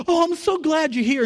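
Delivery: high, loud gushing welcome; joyful welcomer. Retold or imitated. Crowd welcomes the grieving mother, high and joyful. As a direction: greet her like a long-awaited friend. People welcoming her into heaven.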